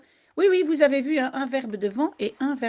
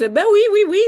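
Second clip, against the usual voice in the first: excited; 9 semitones higher, 6 dB louder, much faster than usual. Real, not acted. Teacher greets her enthusiastic class.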